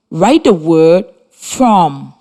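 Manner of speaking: slow, careful dictation. Shown as a demonstration. Radio teacher dictating a word to pupils, slowly. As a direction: spell it out slowly for pupils copying. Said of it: "dictating a spelling word".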